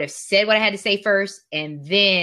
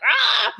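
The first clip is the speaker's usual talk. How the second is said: frustrated yell. Retold or imitated. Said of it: "I'm like".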